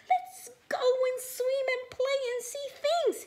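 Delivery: high, loud, excited little fish; bright eager invitation, very high and loud; happy. Acted. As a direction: squeal it with joy. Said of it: "he said happily".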